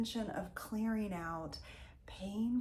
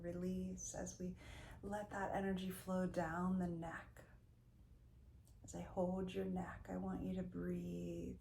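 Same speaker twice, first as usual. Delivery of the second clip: guided meditation; 7 dB quieter than usual. Real, not acted. Leads a Reiki body-scan meditation.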